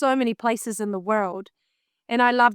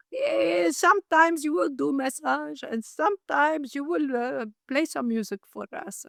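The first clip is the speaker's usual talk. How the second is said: put-on old boss voice; 5 semitones higher than usual. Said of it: "It's like".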